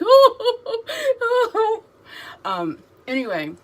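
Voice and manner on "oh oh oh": very high, loud crying wail; sobbing oh. Retold or imitated. Overcome at the cameo, loud high crying wail. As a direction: wail it out through sobs. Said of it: "again, I was like".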